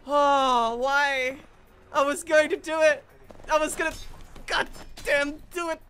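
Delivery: loud, high anguished whine; wailing complaint. Real, not acted. Player robbed of his moment, loud wailing complaint. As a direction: lament it at full volume. Kill taken from him.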